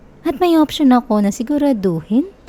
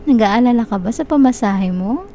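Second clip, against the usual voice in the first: ordinary talk, nothing marked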